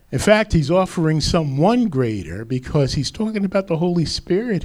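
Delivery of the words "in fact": ordinary talk, nothing marked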